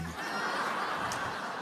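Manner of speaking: real breathy laugh. Real, not acted. Storyteller amused at the fat king's capture, laughing. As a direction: laugh at your own story.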